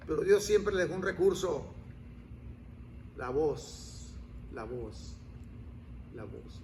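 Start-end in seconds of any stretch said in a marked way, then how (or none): 3.2-6.6 dropping softer each time, almost breathed; hushed repetition. Acted. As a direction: let each la voz fade lower and quieter. Repeating the gift of voice. (cada vez más bajo y suave)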